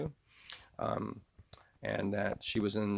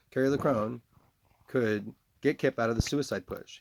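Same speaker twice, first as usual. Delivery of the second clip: ordinary delivery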